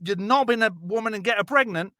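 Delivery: ordinary talk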